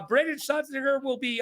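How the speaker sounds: normal talking voice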